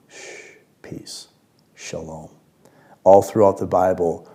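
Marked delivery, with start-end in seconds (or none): 0.1-2.3 whispered shh and peace, soft shalom; soothing hush. Shown as a demonstration. Preacher hushing the congregation gently. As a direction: breathe it out like calming a child.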